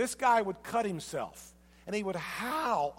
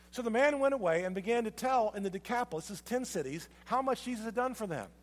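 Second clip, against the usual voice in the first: ordinary delivery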